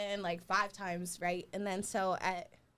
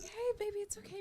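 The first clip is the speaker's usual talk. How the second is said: soothing; 11 semitones higher, 3 dB quieter than usual. Retold or imitated. Soothing the angry man.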